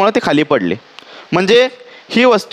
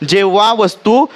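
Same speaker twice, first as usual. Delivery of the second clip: slow dictation; slower than usual. Shown as a demonstration. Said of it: "dictating in Marathi".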